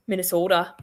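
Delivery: "Minnesota" with a long Minnesotan o; light imitation of a Minnesota accent on "Minnesota". Shown as a demonstration. Mimicking how Minnesotans say their state, Minnesota accent. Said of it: "that's how you guys talk".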